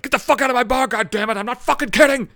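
livid bartender's high, loud shout; furious yelling. Retold or imitated. Livid bartender throws a guy out of his bar, yelling. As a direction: bellow it like a barkeep throwing someone out. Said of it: "Starts yelling at this pussy piece of shit".

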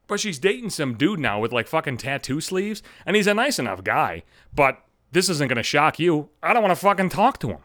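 ordinary talk, nothing marked

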